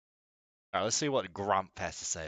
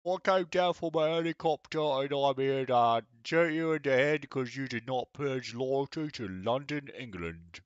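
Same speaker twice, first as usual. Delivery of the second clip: mock British accent; 5 semitones higher than usual. Mock British soldier rant.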